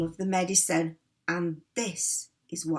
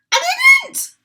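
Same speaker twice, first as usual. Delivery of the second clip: cackling; 14 dB louder than usual. Acted. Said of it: "cackled the old woman".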